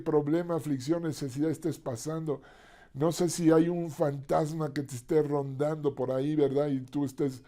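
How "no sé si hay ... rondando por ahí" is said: ordinary talk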